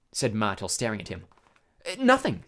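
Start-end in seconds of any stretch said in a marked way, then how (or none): 1.9-2.4 hasty, higher denial; quick. A narrator's reading. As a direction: brush it off hurriedly, pitched up. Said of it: "said Harry quickly".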